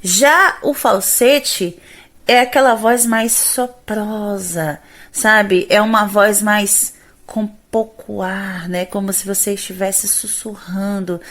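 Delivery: usual speaking voice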